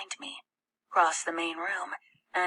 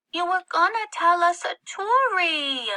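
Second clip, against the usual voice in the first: put-on much higher, louder little girl whine; a small girl pleading for her story; 12 semitones higher, 8 dB louder than usual. A narrator's reading. Little girl complaining from bed.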